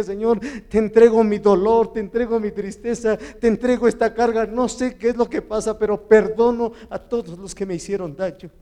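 loud, high, impassioned surrender; retold tearful prayer. Preacher reliving his kneeling prayer, loud and anguished. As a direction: pour out grief to God, preaching it. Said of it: "me hinqué llorando y le dije".

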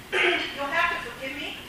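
ordinary delivery

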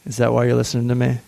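ordinary talk, nothing marked

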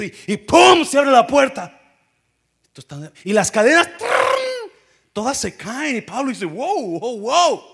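loud, high, building dramatic retelling; fiery preaching. Acted. Preacher to congregation, shouting the jail doors open.

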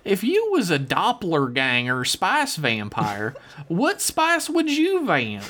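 slow, high country drawl; folksy hillbilly letter-writer. Acted. Host reading David S.'s email to the crew, hammed-up country drawl. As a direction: read it as a drawling backwoods yokel. Reads listener email in character.

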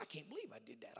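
soft, whispery retold amazement; hushed disbelief. Bishop told what he had forgotten, hushed disbelief. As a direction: breathe it out, imitating disbelief.

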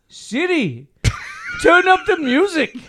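mock Indian accent. Mates hear him put on an Indian accent for the speaker. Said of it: "this is the accent I used".

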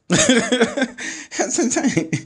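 high, helpless laugh through the words; laughing disbelief. Real, not acted. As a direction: laugh it out slowly, amazed. Laughing over the kiss.

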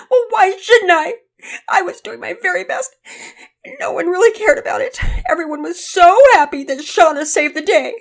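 high, loud, tearful outburst; upset and angry crying. A narrator's reading. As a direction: wail self-pityingly at a parent. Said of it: "still very upset and even angry".

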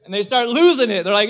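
normal talking voice

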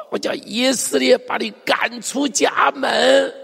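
high, drawn-out stern banishment; fierce threat. Acted. Speaking to the child, banishing fiercely. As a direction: pronounce it slowly and high, like a sentence. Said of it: "前面是说得很凶".

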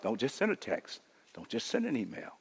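dropped to a soft, low hush; hushed reprise. Shown as a demonstration. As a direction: bring it down to a near murmur. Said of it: "let's make sure we show up".

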